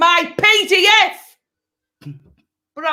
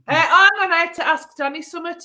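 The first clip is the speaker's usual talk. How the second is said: normal talking voice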